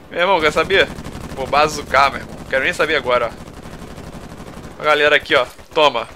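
loud reckless battle yelling; excited shouting. Real, not acted. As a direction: yell it while firing wildly. Firing bazooka at enemies.

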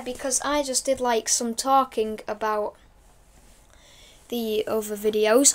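ordinary talk, nothing marked

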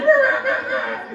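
high, sustained squealing engine noise; shrill imitated tire screech; car screech imitation. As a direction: mimic a little car peeling out.

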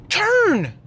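sudden high shout of "Turn"; yelling at a driver. Real, not acted. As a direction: bark at the car in front.

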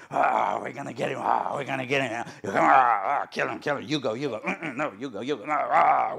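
loud boastful soldiers, half laughing; mock soldiers. A hint of soldiers boasting then pushing each other forward. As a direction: play the cowardly army passing the buck. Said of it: "they were screaming".

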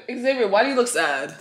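usual speaking voice

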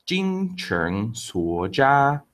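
Jing Cheng Suo Jia drawn out very slowly; slow idiom recap. Shown as a demonstration. Teacher repeating the idiom for the class, very slow. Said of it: "That's a lot of sincere effort".

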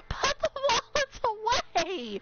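high, drawn-out cry; high, stretched-out exasperation; exasperated plea. Real, not acted. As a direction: wail it slowly at the generous crowd. Begging viewers to stop gifting.

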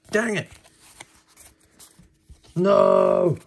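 loud, high cry of disappointment; frustrated outburst. Real, not acted. Missing the pull he hoped for, loud frustrated no. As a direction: blurt out your frustration. Misses the card he wanted.

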